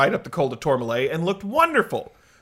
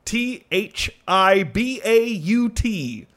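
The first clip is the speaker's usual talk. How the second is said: slow spelling; much slower than usual. Shown as a demonstration. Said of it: "how Thibaut spelled".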